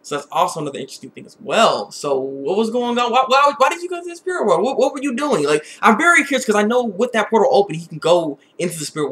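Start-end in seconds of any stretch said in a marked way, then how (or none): none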